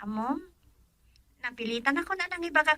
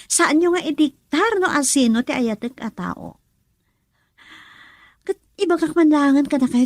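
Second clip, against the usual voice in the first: loud, heated declaration; defiant outburst; 9 dB louder than usual. Acted. Daughter loudly declares her love to her disapproving mother. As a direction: tell her off at full voice.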